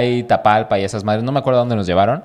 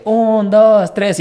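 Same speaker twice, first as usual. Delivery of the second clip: counting out loud; 9 semitones higher, 5 dB louder, much slower than usual. Retold or imitated. Contando en la fiesta.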